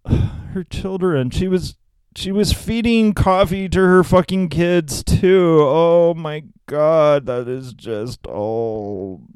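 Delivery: high, slow hammed-up weepy lament; mock tearful. Mocking Sister Julie's tears over coffee-drinking children, fake weepy. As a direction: badly fake being overcome with emotion. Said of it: "I'm about to get emotional here".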